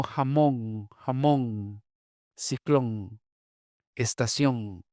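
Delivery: "jamón, ciclón, estación" ending in ng; final n at the back of the mouth in "jamón"; velar final N. Shown as a demonstration. Linguist listing forms shared with other dialects, velar N. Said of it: "velarización de N".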